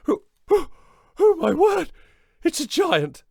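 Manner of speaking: very high loud shriek of fright; terrified mother. Acted. Mother seeing the giant before fainting, shrieking. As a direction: shriek in terror.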